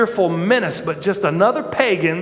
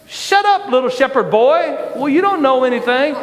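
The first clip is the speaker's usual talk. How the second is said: much higher, dismissive snap; mock angry retort; 5 semitones higher than usual. Big brother to little shepherd boy, mock angry put-down. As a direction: imitate an irritated brother cutting him off. Acting older brother snapping at David.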